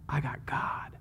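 quiet, whispered declaration of faith; hushed conviction. Real, not acted. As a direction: drop to a whisper for emphasis. Preacher drops to hushed personal conviction.

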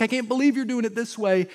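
voicing a frustrated prophet, loud and high; mock frustration. Prophet objects to God's way of working, acted frustration. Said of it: "when he's probably frustrated with God".

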